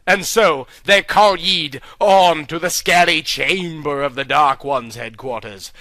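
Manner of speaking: ordinary delivery, nothing marked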